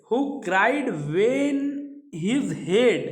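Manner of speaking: each word read out slowly; measured recitation of verse; slow poem reading. Shown as a demonstration. Teacher reads poem line slowly.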